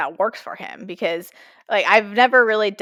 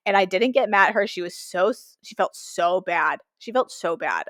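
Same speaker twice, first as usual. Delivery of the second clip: usual speaking voice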